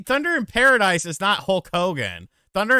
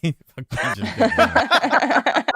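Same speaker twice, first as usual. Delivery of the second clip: laughing; 8 semitones higher, 5 dB louder, much slower than usual. Acted. Joke chant, laughing.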